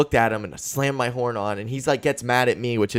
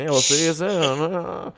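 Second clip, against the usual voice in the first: higher, slow teasing farewell; mock undertaker; 5 semitones higher, slower than usual. Joking as funeral director to old folks, slow mock farewell. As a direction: draw out the ominous goodbye.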